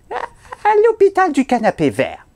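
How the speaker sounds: l'hôpital du canapé vert swooping very high in a mock Haitian accent; bad Haitian accent. Fake nurse names the hospital, squeaky bad Haitian accent. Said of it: "accent poche haïtien".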